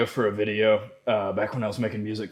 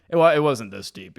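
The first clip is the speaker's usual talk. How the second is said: ordinary delivery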